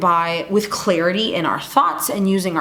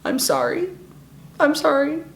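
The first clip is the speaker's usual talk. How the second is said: tearful apology; 5 semitones higher than usual. Retold or imitated. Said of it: "she's like, ... starts getting emotional".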